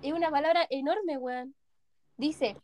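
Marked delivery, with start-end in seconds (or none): none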